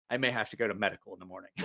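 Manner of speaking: low, soft, quick aside; retold sheepish admission. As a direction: imitate a hurt buddy quietly owning up. Hurt Mikey admits it privately.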